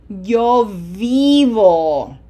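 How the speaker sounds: "vivo" very slow and loud, with a stretched "ee"; long I. Shown as a demonstration. Teacher models the verb for students, long "ee" in "vivo". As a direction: hold the first vowel of "vivo" as a long "ee". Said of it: "It has to be long".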